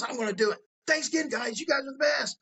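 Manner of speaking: high, gushing and shouted by the end; excited high thanks. Retold or imitated. Prostitute thanking the crew before jumping in, high and excited. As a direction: thank them like they changed your life.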